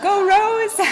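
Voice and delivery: high, loud rallying cheer; cheerleader shout. Acted. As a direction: shout it like a cheerleader with pom-poms. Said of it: "get pom-poms and shout".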